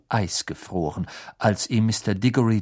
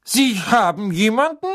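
doubtful; 10 semitones higher, 4 dB louder than usual. Acted. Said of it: "mit zweifelndem Unterton".

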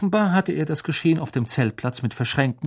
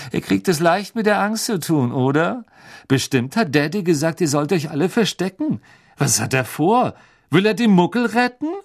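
put-on sneering rich boy; 6 semitones higher, 3 dB louder than usual. A narrator's reading. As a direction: look down on them and enjoy it. Malfoy mocking.

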